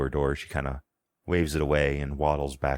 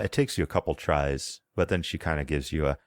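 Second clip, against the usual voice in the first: ordinary talk, nothing marked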